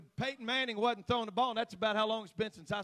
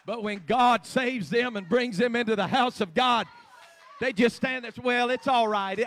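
louder and higher, building into a shouting sermon; preaching at full force; 6 semitones higher, 16 dB louder than usual. Acted. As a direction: preach it till the room rings.

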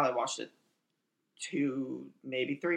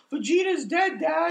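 high, loud yell of disbelief; kid's shocked yell; 9 semitones higher, 5 dB louder than usual. Retold or imitated. Kid yelling to dad about Vegeta's death, high loud shout. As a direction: shout it out like a stunned child. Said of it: "We're all like".

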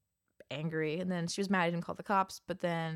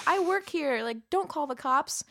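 worker insisting; 7 semitones higher, 5 dB louder, slower than usual. Retold or imitated. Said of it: "She goes".

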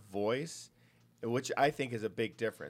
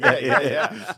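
laughing; 9 semitones higher, 14 dB louder, slower than usual. Real, not acted. Laughing at the voice demo.

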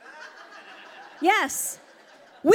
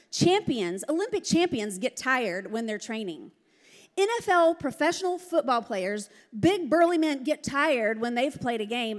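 usual speaking voice

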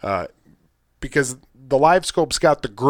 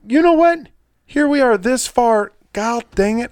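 much higher and louder, a burst of frustration; sudden exasperated outburst; 10 semitones higher, 4 dB louder than usual. Real, not acted. Host realizing he forgot the sponsor, high exasperated burst. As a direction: jump up in pitch as if you just remembered something.

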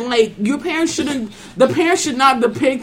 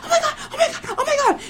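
gasping, much higher pitch; high panicked gasps; much faster than usual. Retold or imitated. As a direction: pant it out in a panic. She, like, breathing like.

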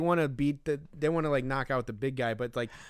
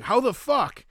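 sudden high, loud mock outburst; retold outburst; 9 semitones higher, 6 dB louder than usual. Roy melting down at the field, high loud mock outburst. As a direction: blurt it out like a man losing it over a stolen bat. Roy in full meltdown over bat.

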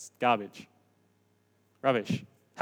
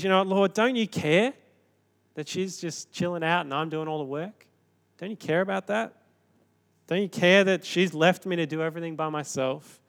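Martha complaining; 4 semitones higher than usual. Retold or imitated.

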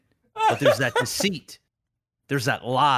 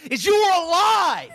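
high, hammed-up betrayed voice; mock jilted lover; 13 semitones higher, 5 dB louder than usual. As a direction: scold it like a heartbroken ex, pitched way up. Scorned lover telling off Cyberpunk.

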